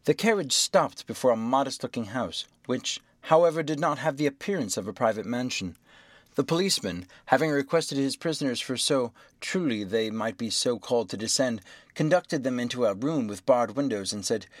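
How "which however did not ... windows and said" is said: ordinary delivery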